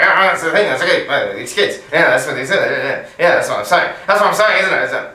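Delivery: loud rambling rant, words running on; ranting tram guy. Retold or imitated. Retelling the tram crank ranting to the carriage. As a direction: mimic a man ranting to nobody.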